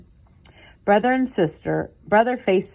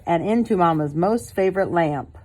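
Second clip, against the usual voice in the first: ordinary delivery, nothing marked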